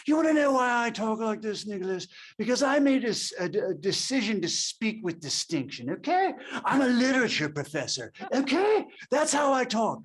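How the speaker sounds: put-on a professor defending how he speaks, in a mid-Atlantic accent; August Coppola mid-Atlantic accent. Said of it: "he had a mid-Atlantic ass-, uh, accent".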